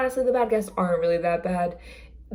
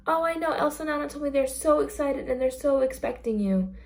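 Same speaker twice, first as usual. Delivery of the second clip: higher, sweet make-believe tone; storybook cast voice; 6 semitones higher than usual. Acted. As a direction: play along with the magic for a child. Cast member telling kid princesses wait.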